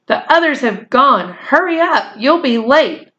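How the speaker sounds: mother hurrying her son from downstairs; calling out. Acted. Mother calls upstairs.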